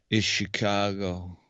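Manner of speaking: lightly voiced Kevin Costner, slow and low; a hint of Costner, drawn out; Kevin Costner impression. Podcast host tries Kevin Costner for his cohost. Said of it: "Kevin Costner".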